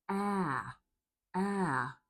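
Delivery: "Ah" as the drawn-out vowel in cat; "Ah" said as an isolated, open front vowel; open vowel demo. Shown as a demonstration. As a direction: drop the jaw low for a long "Ah". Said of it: "drop your jaw really low".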